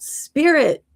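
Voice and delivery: high called-out word; mock shout. Woman walking by, calling out in mock shout. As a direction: call it out like a passerby. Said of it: "she shouted out".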